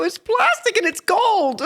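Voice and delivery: slow, high, loud and incredulous; exasperated wife. Retold or imitated. Wife correcting husband at the banquet, slow, high exasperation. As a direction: spell out the obvious, slowly. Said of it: "I go".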